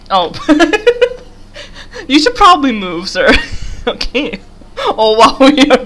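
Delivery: usual speaking voice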